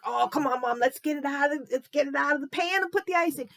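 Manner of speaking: put-on high, fast, pleading little girl; eager child. Impatient girl begging her mom, quick and high-pitched. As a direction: beg Mom like an impatient kid. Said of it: "It's like".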